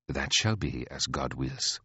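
low, slow, soft reply; unruffled queen. Acted. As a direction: answer with quiet composure.

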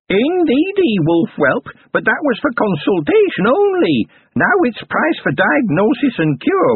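high, slow crone voice, sly; haggling goblin crone. A narrator's reading. Goblin granny naming her price to Carrot, sly high crone. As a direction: bargain like a sly old goblin.

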